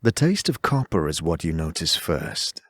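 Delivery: ordinary talk, nothing marked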